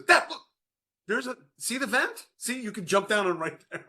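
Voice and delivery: imitating an excited son at the window; excited kid. Son shows dad the vent, eager high call.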